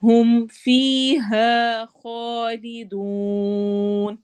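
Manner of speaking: slow tajweed recitation with long madd; teacher recites slowly, stretching each vowel; Quran recitation. Shown as a demonstration. Tajweed teacher demonstrating for students, slow drawn-out recitation. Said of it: "فی اور ہا دونوں پہ یا مدہ اور الف مدہ".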